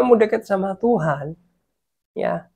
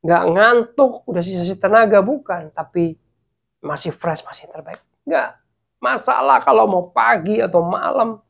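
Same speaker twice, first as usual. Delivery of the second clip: ordinary talk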